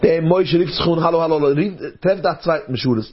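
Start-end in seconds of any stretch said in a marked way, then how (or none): none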